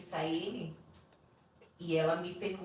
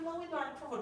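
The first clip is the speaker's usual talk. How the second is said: higher-pitched, worried pleading, re-enacted; retold anxious plea; 8 semitones higher, faster than usual. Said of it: "porque eu fiquei com medo".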